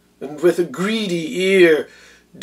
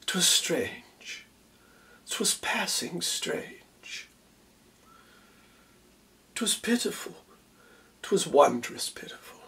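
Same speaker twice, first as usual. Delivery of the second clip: very soft, half-whispered quote with long pauses; hushed awe; 2 semitones higher, 12 dB quieter than usual. Retold or imitated. Othello quotes Desdemona's reaction to his tale, hushed and wondering. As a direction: barely breathe the words, marvelling.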